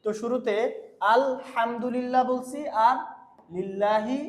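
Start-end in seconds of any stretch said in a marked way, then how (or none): none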